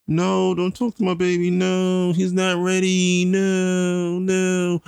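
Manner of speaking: high, pleading mock mom; high mock mommy voice, slow on he's not ready; overprotective mommy. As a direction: mimic a fretful mother shielding her baby. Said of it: "from a mommy perspective".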